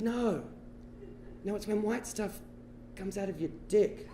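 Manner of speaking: soft, halting explanation; hushed correction. Retold or imitated. Older brother corrects the boy's mistake, hushed correction. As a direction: break it to him very quietly, with pauses.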